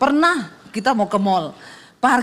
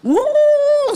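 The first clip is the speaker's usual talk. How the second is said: a high whooshing engine roar; 4 dB louder than usual. Retold or imitated. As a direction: let the engine surge. Car accelerates up the ramp. (deru mesin melengking)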